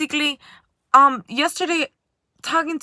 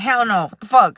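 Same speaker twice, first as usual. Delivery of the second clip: louder mock teen brush-off; teen scoff; 2 semitones lower, 8 dB louder than usual. Older kids turning down her meditation offer with a loud scoff. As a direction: toss it off like a rude teenager.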